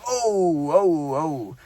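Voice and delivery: mock groans of discomfort; imitated pained noises. Imitating Santa as kids sit on his lap, pained noises. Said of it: "making noises as the kid sits on him".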